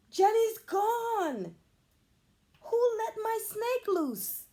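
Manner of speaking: high, slow panicked shout. A narrator's reading. Emily shouting to the class about her snake, high and panicked. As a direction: cry out that the snake is gone. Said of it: "Emily shouts".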